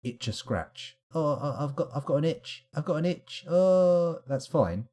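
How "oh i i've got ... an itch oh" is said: imitating itchy complainers. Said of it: "When people say they need to".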